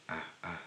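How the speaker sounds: soft ah, ah from the back of the throat. Shown as a demonstration. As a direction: open mouth, ah, ah from the throat. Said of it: "it's almost like a scream".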